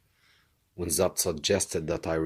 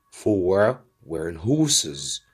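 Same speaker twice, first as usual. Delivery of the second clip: plain reading voice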